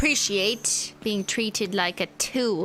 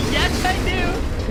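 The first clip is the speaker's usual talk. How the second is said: high, loud, slow taunting yes; mocking defiance; 8 semitones higher, 6 dB louder, slower than usual. Arguing with the game character, mocking slow defiant taunt. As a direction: drawl it back at the game to spite it. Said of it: "Nope, I don't want to go there".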